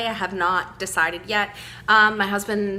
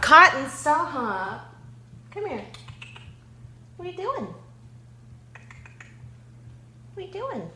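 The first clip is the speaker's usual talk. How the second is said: exasperated; high pleading call to a pet; scolding the cat; 9 semitones higher than usual. Real, not acted. Scolding the cat mid-filming.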